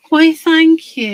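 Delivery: high, loud, slow "why, thank you"; high, hammed-up gracious thanks; mock-flattered thanks. Real, not acted. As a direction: play the flattered lady. Responds to compliment in chat.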